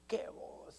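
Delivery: soft, breath-only exclamation; hushed awe. Retold or imitated. Preacher reacting to Abraham's voice, hushed awe. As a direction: murmur it in wonder.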